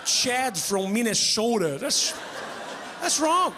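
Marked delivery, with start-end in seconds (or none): 1.8-3.5 much higher, indignant mock protest; mock outrage. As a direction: feign shock at the injustice.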